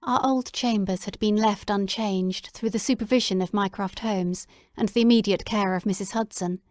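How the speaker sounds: plain reading voice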